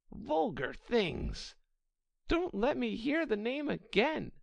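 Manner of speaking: high-pitched, offended mouse voice; indignant mouse. Acted. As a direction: scold haughtily in a high voice, drawing out the insults. Offended mouse rebukes Alice.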